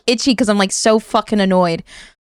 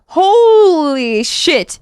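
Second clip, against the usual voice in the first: drawn-out, higher exclamation; exasperated; 7 semitones higher, 3 dB louder, much slower than usual. Real, not acted. Streamer fed up with media, exasperated drawl. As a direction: stretch HOLY right out in exasperation.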